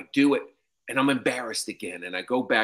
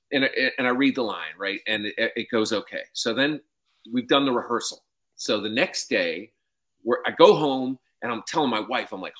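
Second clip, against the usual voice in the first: normal talking voice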